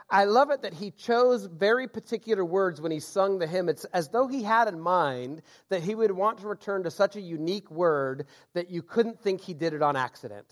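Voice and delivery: usual speaking voice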